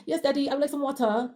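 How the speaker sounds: British "water", the final r dropped; British accent. Retold or imitated. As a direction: imitate the kids switching to British English. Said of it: "Do they switch up the accent".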